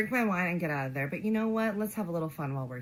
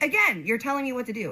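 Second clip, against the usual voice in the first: retold loud, higher-pitched retort; getting loud; 8 semitones higher, 7 dB louder than usual. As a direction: snap back louder, as if retelling a quarrel. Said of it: "I'm kinda getting loud".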